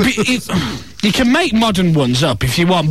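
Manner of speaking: ordinary talk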